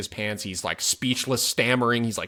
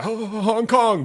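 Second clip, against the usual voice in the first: much higher, slow, strained imitation of the terrified man; mock frightened answer; 11 semitones higher, 6 dB louder, much slower than usual. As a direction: squeak out the answer like the scared man. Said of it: "the other guy, yeah".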